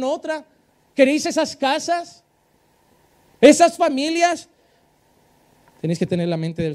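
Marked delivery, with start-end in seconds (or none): 0.9-4.5 louder, higher challenge, slow; preaching challenge. Acted. Pastor confronting the congregation, rising rebuke. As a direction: call out the congregation.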